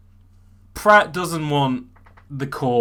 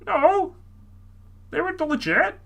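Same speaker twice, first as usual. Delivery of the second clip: high indignant protest; 13 semitones higher than usual. Acted.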